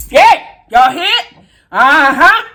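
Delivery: sudden high triumphant yell; excited shout. Real, not acted. Catching something on camera.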